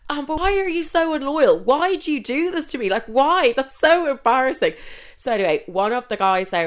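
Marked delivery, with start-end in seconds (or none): 0.1-4.7 high, loud, whiny mock betrayal; playful fake outrage at her friends; mock outraged whine. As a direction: scold the girls in a hammed-up wounded whine. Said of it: "I was like".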